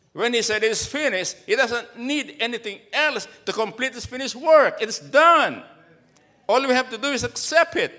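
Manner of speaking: preaching. Acted. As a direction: proclaim it to the congregation. Preaching at full force.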